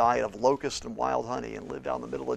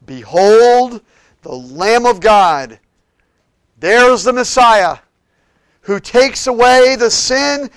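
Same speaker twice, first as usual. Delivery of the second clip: loud, slow, high cry of Behold; shouted proclamation; 10 semitones higher, 19 dB louder, much slower than usual. Acted. Preacher crying out John's words to the crowd, shouting. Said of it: "It's like he shouted it".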